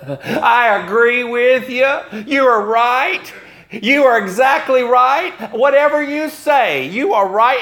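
butter him up shamelessly; the prince gushing to a petitioner, high and syrupy; oily flattery. Retold or imitated.

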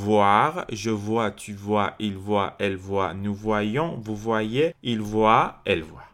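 whole conjugation run together without long pauses; joined-up recitation. Shown as a demonstration. Teacher reviewing the conjugation of voir, in one continuous run. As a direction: recite the forms as one connected list.

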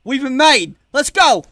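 high, very loud battle cry; sudden full-volume call to run; alarmed shout. Acted. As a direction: yell it out to rally the group.

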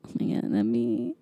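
low unvoiced awe; whisper. Real, not acted. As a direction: hush it out, overwhelmed.

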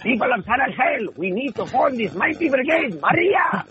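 high, rallying Mexican general voice; grand heroic proclamation, soaring on the last word; General Posada. Shown as a demonstration. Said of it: "So I got to play General Posada".

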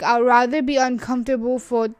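usual speaking voice

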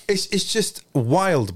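slow, stretched-out wild; long drawn-out wild. Real, not acted. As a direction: let it drag in disbelief. Amazed by the clips.

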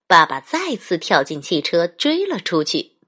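plain reading voice

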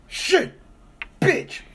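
high mock yell of a stranger; high pitched cursing outburst; imitated yell. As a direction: yell it like the angry guy in line. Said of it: "all you hear is".

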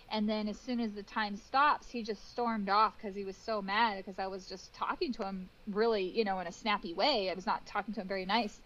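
ordinary talk, nothing marked

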